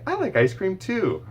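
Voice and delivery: put-on high, bright child voice; imitated kid echoing; child repeating. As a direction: chirp it back like a kid. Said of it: "the kids might say".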